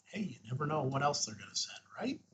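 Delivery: ordinary talk, nothing marked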